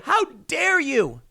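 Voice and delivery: slow, high-pitched sarcastic How dare you; mock outrage. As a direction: sneer it slowly like a scandalised critic. Mocking critics of the cop.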